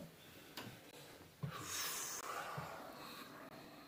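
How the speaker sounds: long, soft breathy exhale; long sigh. Real, not acted. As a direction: let out a slow, drawn-out sigh. Long exhale while settling in.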